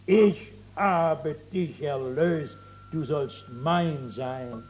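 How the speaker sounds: a lightly voiced gentle saviour; hint of a tender Jesus, slow and hushed. Preacher voices Jesus speaking tenderly.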